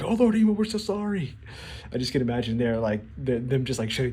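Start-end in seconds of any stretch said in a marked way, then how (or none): 0.0-1.4 high, hammed-up cringing sorry; groveling apology. Gorosei apologise to Imu for the burnt house, high and groveling. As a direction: grovel before your furious lord.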